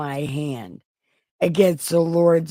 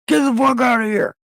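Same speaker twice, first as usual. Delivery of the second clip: fast, high shout; mock shout; 7 semitones higher, 4 dB louder, faster than usual. David driving Saul off with a sudden shout. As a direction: shout them away, fast and loud. Acted outburst ending the quote.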